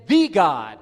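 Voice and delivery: loud, weighty "The"; stress on the. Shown as a demonstration. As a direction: punch "The" before "God". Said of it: "The definite article's there".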